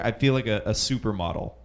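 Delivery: normal talking voice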